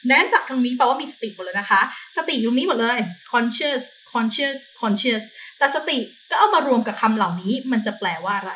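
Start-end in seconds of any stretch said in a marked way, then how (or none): 1.4-5.2 slow repeated drill. Shown as a demonstration. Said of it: "drilling the word".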